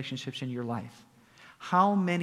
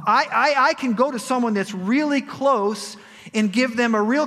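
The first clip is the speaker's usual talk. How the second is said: high, loud, driving preacher build; preaching at full force; 10 semitones higher, 8 dB louder than usual. Acted. Pastor preaches to the congregation about conviction, fervent and loud.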